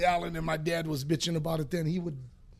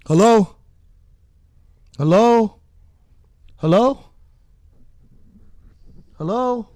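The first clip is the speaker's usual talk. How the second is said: repeated hellos, much louder, rising; loud impatient hello; 12 dB louder than usual. Acted. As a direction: call out into a silent phone line. Impatient caller not answering on air.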